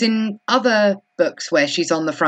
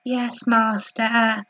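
slow, slightly raised meek mock voice; 4 semitones higher, much slower than usual. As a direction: draw it out like an obedient servant. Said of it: "Elizabeth just meekly goes".

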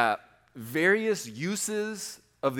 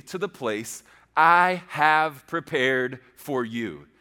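slow, louder "I have prepared for you", word by word; emphatic scripture reading; 5 dB louder, much slower than usual. A narrator's reading. Key verse read with heavy emphasis.

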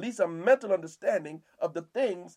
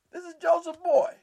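much higher, louder incredulous scoff; scornful townsfolk; 11 semitones higher, 5 dB louder than usual. Retold or imitated. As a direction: sneer at the local boy.

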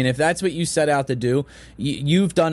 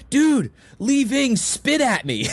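higher, drawn-out excited brag; 10 semitones higher, slower than usual. Retold or imitated. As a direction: imitate a thrilled fan, stretched and laughing. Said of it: "I remember how excited he was".

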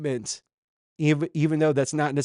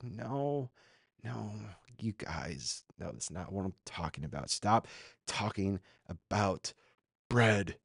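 low, much softer, weary of them; quiet exasperation; 3 semitones lower, 9 dB quieter than usual. Acted. Jesus to disciples who keep missing the point, quietly exasperated. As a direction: drop the voice and slow to a halting plea.

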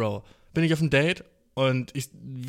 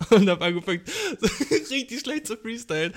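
words laughed out, pitched up; laughing while talking; 7 semitones higher, 3 dB louder than usual. Real, not acted. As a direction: laugh through the sentence. Laughing at own freestyle. (lachend erzählt)

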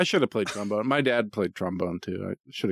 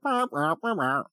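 bad trumpet imitation; 5 semitones higher than usual. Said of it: "like, super shittily while they're trying to play".

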